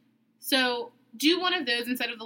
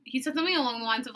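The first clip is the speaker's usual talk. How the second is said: normal talking voice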